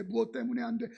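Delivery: ordinary talk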